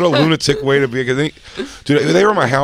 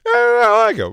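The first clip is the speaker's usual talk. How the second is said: high sheepish voice; 12 semitones higher, 3 dB louder, faster than usual. Retold or imitated. Defending a friend.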